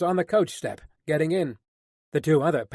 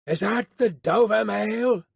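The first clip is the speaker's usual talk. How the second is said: slow, raised call through mist; calling from afar; 5 semitones higher, slower than usual. A narrator's reading. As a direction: hail the coach, drawing out the words. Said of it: "a man's voice called from the mist".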